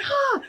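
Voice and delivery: one high gasp; acted hyperventilating. Replaying hyperventilating after being pulled away, high gasp. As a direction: suck in a panicked gasp. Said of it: "I'm hyperventilating".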